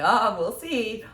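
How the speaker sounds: slow, loud, teasing "we'll see"; joking doubt. Retold or imitated. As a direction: drag it out like a doubtful joke. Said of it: "the tone of your voice".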